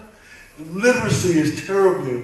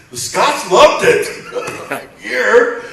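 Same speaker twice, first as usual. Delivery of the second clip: laughing; 8 semitones higher, 8 dB louder, much slower than usual. Real, not acted. Laughs while telling.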